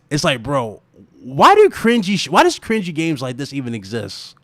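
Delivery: usual speaking voice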